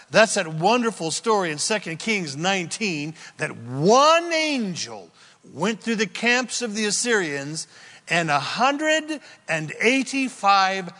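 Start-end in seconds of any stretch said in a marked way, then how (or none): none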